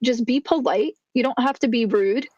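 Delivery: ordinary talk, nothing marked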